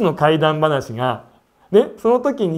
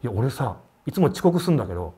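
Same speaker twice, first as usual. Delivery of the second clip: trembling fear; 6 semitones lower, 3 dB quieter than usual. Retold or imitated. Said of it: "ブルブル震えてて".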